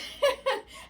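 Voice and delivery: breathy high-pitched giggle. Real, not acted. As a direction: giggle with delight. After the excited squeal.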